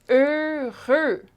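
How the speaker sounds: "Heureux" in two held syllables, both closed eu; syllable demonstration. Shown as a demonstration. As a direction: pitch up, stretch each syllable of "Heureux". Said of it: "two syllables".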